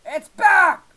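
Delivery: high, very loud outburst; real frustrated scream; exasperated yell. Real, not acted. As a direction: shout it in dismay. Lag returns, yells.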